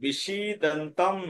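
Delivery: বিষিদন্তম sounded out very slowly; slow, careful model of বিষিদন্তম; demonstrated pronunciation. Shown as a demonstration. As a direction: stretch each syllable of বিষিদন্তম. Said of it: "উচ্চারণটা কি করবো".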